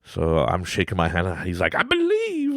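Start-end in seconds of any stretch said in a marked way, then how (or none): 1.8-2.6 put-on high, excited child voice; little son. Son telling dad he believes, high child voice. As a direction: squeak it out like a small boy who believes.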